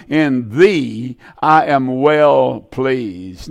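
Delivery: slow, weighty reading of God's words; slow solemn scripture reading. Acted. Preacher reading God's words to his Son slowly and solemnly. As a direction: let each word of the verse land slowly.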